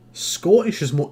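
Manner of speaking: ordinary delivery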